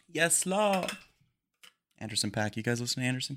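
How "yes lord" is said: higher, mock-reverent yes Lord; rapper catchphrase. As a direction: toss off a rapper's ad-lib.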